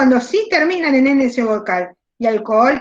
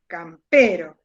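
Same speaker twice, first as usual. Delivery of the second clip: "campero" with its middle syllable set out and stressed; 6 semitones lower, slower than usual. Shown as a demonstration. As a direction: lower "campero", leaning on a drawn-out PE. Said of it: "porque su fuerza".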